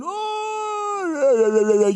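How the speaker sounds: hammed-up pompous high "Oh". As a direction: put on airs, high and drawn out. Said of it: "when you start answering a bit pompous".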